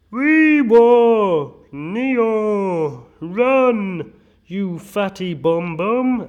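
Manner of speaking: put-on high, long drawn-out childish babble; mock nursery kids. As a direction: mimic little kids, stretching every word up high. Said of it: "they went into a primary school slash nursery".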